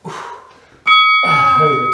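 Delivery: slow strained push; straining effort. Real, not acted. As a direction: squeeze it out under effort. Straining on last reps.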